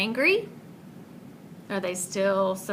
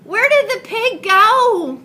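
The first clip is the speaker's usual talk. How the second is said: much higher, louder worried question; animals crying out; 11 semitones higher, 8 dB louder, slower than usual. Acted. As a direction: animals call out in alarm. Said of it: "the animals cried".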